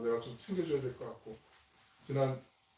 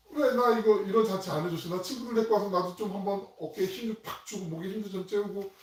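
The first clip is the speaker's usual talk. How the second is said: higher and louder, complaining hard; older son berating his father, mock indignant; indignant demand; 5 semitones higher, 4 dB louder than usual. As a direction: whine at your father about the party you never got. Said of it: "라고 이렇게 따지는 거란 말이죠".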